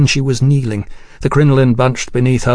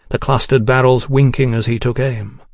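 plain reading voice

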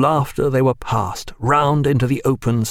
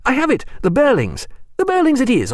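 triumphant recall; 14 semitones higher than usual. Acted. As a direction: burst out as the name comes back. Sudden recall of the name.

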